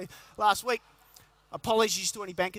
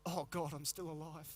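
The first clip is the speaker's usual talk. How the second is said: much softer and lower, groaned; groggy morning thought; 5 semitones lower, 11 dB quieter than usual. Retold or imitated. Waking up to a bad morning, low weary groan. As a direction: drag it out like barely waking. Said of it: "your first thought of the day being".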